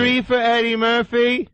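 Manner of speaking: hammed-up ticket buyer; clueless moviegoer. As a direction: play a dopey customer. Moviegoer impression continues.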